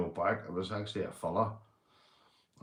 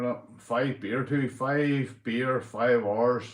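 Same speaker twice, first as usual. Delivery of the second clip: usual speaking voice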